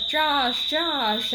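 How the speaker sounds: mock yell of a distracted mother; yelling a name. Mother yelling at her kid instead of watching the baby, loud call. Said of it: "she, like, turned around and was like".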